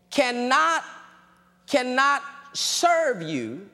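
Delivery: ordinary talk, nothing marked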